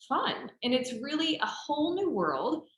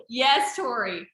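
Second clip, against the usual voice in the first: normal talking voice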